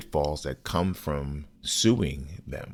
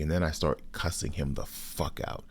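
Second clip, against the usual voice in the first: normal talking voice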